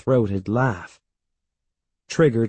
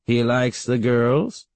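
slow, prying question; drawn-out tease rising at the end; teasing great-aunt; 2 semitones higher, slower than usual. A narrator's reading. Great-aunt quizzing the boy's mother, slow teasing question.

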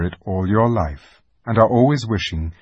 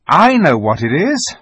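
put-on boy voice; 6 semitones higher, 7 dB louder than usual. Peter voiced as excited boy.

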